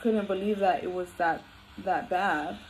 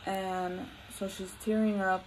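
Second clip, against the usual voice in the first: ordinary talk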